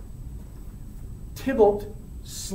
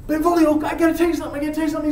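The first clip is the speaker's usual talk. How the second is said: high, hurried insistence; acted panicked confiding; 7 semitones higher, much faster than usual. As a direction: blurt that you must confess. Romeo about to confess, panicked.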